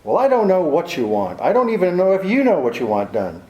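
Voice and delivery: heated, exasperated threat to a client; exasperated outburst. Acted. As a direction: lose patience and lay down an ultimatum. Exasperated detective threatens his evasive client.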